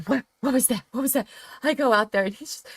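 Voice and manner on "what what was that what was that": higher, startled what was that; alarmed. Retold or imitated. Mom hearing her son sobbing, startled and alarmed. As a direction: call out in sudden alarm. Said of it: "And I'm like".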